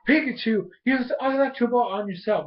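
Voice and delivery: high-pitched hero calling an attack; trainer's battle command. Retold or imitated. Ash orders Pikachu mid-battle, high imitated command. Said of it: "And then he goes in".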